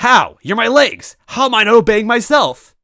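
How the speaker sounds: high, loud disbelieving robot; outraged robot. Acted. Robot villain to disobeying toad, outraged. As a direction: shout at your own legs in disbelief.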